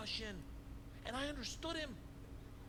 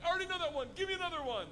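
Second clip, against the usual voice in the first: eager boast; much faster than usual. Retold or imitated. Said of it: "I was like".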